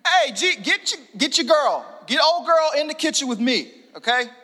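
high, loud casual nagging; hammed-up demand to get her sister; mock Martha. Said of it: "That's probably how she sounded".

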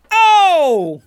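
long, loud, high cry; hammed-up high yell; mock astonished shout. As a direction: bellow a cartoonish reaction, high-pitched. Acted cartoon heroes reacting.

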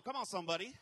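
ordinary talk

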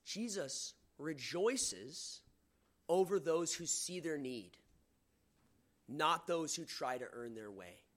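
"Jesus rejoices" drawn out very slowly, each word landing apart; a preacher slowing to a crawl for the key line; slow weighty delivery. Shown as a demonstration. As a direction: lay down the main point word by word, very slowly. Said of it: "That's the main point of this text".

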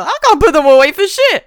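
high, loud exasperated cry; hammed-up indignant outburst; mock outraged refusal. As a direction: protest at the top of your voice. Voices Vander's outraged refusal.

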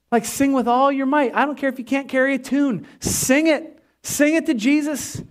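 rally them to sing; rousing exhortation. Acted. Pastor urging the congregation to sing, rousing.

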